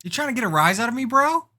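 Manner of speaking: high, fast taunt. Acted. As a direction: needle him, fast and high. Taunting quote.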